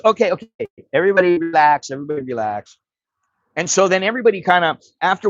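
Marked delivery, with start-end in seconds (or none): 0.0-1.9 high-pitched, hurried calming of the room; placating lawyer. Retold or imitated. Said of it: "And the lawyer goes". 1.9-2.8 softer repeat to settle the room, imitated; hushed-down lawyer calming everyone; placating lawyer. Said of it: "And the lawyer goes".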